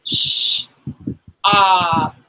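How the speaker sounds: "Sh-" hushed, then "op"; sound by sound. Shown as a demonstration. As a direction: tap out "Sh-" and "op" on the arm. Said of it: "On your arm".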